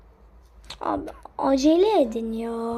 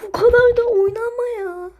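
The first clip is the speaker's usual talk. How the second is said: exasperated scolding; 8 semitones higher than usual. Real, not acted. Scolding a useless teammate.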